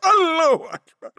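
high, loud ecstatic shout; loud cry. A narrator's reading. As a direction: cry out to God in alarm. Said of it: "به صدای بلند گفتم". (فریاد بلند و زیر)

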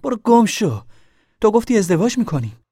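high-pitched angry shout; loud, angry. A narrator's reading. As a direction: yell at him in a lovers' row. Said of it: "دختر با صدای بلند گفت".

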